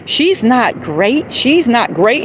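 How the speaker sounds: a lightly put-on catty man's voice; mocking Mister John. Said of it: "And he said".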